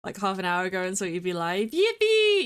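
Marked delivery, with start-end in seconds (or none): 1.7-2.4 high squealed yippee; excited cheer. Retold or imitated. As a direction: squeal it with joy. Imitating cheering chat.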